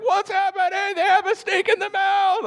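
very high, hammed-up child panic; freaked-out kids. Kids at the giraffe deck freaking out, high mock panic. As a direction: squeal it like a panicking kid. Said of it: "my kids are freaking out".

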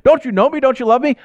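high-pitched panicked pleading; hammed-up desperate prayer; freaking out. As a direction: act out a frantic cry for help to God. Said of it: "when I'm freaking out".

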